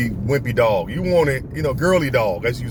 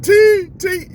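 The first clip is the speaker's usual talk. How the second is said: loud, high shout for a cousin; screaming a name; 8 dB louder than usual. Retold or imitated. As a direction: yell the name to bring help. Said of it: "started screaming out my cousin name".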